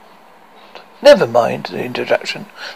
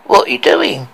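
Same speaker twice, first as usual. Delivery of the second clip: trembling voice. Acted. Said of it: "its voice trembling".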